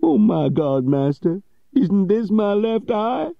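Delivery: put-on high, pleading cry of an old man; frightened old servant. Old servant fearing for his eye, high frightened cry. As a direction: beg his master, scared. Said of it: "cried the old man".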